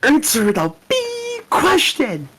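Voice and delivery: sudden high slow squeal; shrieking demand. Acted. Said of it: "I'll be Doctor Lingham".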